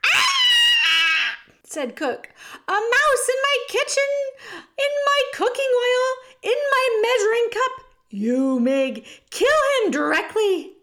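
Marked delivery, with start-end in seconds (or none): 0.0-1.4 long, very high, loud scream; startled scream. A narrator's reading. Cook finds a mouse in the oil, shrieking. 2.7-10.8 high, loud outraged screeching; horrified shriek. A narrator's reading. Cook orders Meg to kill the mouse, screeching.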